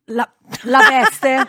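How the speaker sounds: slow, ominous drawn-out reveal; dramatic reveal. Acted. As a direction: drag it out for drama.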